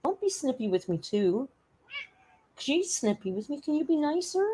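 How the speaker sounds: put-on high, soft baby-talk plea. Streamer pleading with her snippy cat in baby talk. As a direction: coo a hurt little plea.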